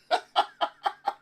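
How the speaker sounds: breathy burst of real laughter; laughing. Real, not acted. Streamer amused by his own story, laughing. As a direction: crack up at your own story.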